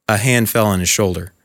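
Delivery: ordinary delivery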